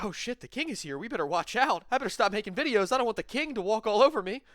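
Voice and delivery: high, fast mock panic; hammed-up terrified fan; mock scared. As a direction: squeak it out like a frightened fan. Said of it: "scared and shaking in our boots".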